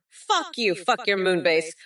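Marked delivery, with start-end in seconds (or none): 0.1-0.7 higher repeat. Shown as a demonstration.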